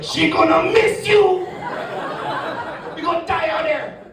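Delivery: put-on comically dire island-accented warning; Caribbean warning. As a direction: cry out the doom in a Caribbean accent. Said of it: "He's like".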